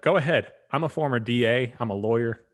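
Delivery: mock bold challenge; retold defiance. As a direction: imitate a woman calling their bluff. Retold defiant answer to police threat.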